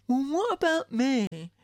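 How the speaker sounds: sulky sibling wanting praise; mock jealous child. Mother imitating a jealous child, high and drawn out.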